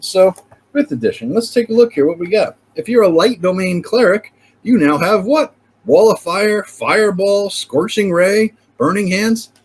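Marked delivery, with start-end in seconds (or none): none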